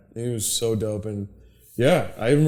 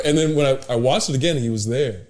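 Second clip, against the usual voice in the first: normal talking voice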